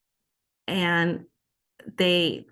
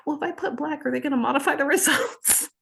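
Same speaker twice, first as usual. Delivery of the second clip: much higher, worried self-quote; high-pitched mock worry; anxious inner voice; 9 semitones higher, faster than usual. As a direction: replay your nervous superstition. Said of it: "I was like".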